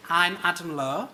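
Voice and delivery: usual speaking voice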